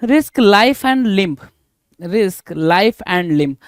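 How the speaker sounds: extremely slow, each word separate; slow dictation. Shown as a demonstration. Dictating idiom.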